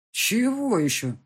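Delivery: slow, high, loud indignation; indignant father objecting. A narrator's reading. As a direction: draw it out in protest. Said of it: "возмутился отец".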